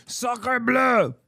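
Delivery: hammy mock French; French accent. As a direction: exclaim like a cartoon Frenchman.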